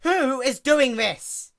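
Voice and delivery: high, slow, loud frantic demand; alarmed. A narrator's reading. Princess demands in panic who attacks her mane.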